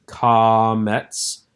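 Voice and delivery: "Comets." with a long ah, as in father; father A pronunciation. Shown as a demonstration. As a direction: draw out "Comets." as "kah-mets". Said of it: "using the A sound from father".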